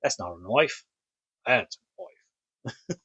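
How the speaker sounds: hammed-up Aussie movie quote, slowing at the end; mock Australian, drawing out the punchline; Crocodile Dundee. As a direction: put on a bad Australian accent, "noif". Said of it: "Love Crocodile Dundee".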